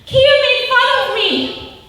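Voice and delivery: put-on high, hurried child's voice, upset; upset little girl. Mom retells daughter complaining about being teased, high upset child voice. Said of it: "she came home that night and she said".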